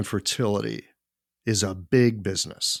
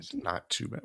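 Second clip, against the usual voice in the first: whispered, much softer aside; wry whisper; 5 semitones lower, 11 dB quieter than usual. Real, not acted. Co-host echoing the herbs' mildness in a soft whisper. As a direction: breathe it back as a dry whisper. Wry echo of guest's line.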